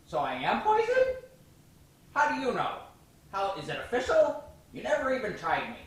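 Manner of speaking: high squeaky frog voice; squeaky, fast cartoon frog. Poison dart frog news report.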